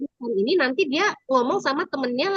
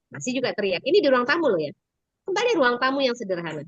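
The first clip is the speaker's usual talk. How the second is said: normal talking voice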